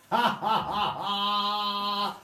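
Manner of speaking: high, loud mock laugh; defiant laugh. Beloved laughs in the face of the knife, high and loud. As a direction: throw your head back and laugh at them. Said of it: "throws his neck back and laughs".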